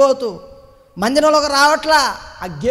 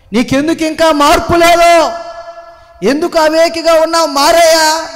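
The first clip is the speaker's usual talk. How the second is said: higher and loud, pleading with the stubborn father; retold pastor's loud pleading call; pleading rebuke; 5 semitones higher, 7 dB louder than usual. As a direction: press him hard to change. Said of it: "చెప్పాడు".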